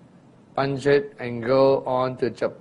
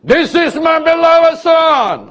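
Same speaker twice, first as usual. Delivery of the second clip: much higher, louder, slow, drawn out; voice like a trumpet; 14 semitones higher, 10 dB louder, slower than usual. Retold or imitated. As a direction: proclaim it like a trumpet from heaven. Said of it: "The voice that says".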